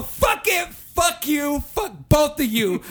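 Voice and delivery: high-pitched retold angry shout; exasperated outburst. Customer cursing out both staff, exasperated shout. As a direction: curse them both out, high and emphatic. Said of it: "I go like this".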